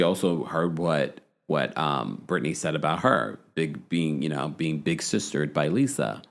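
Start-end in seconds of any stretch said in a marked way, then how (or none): none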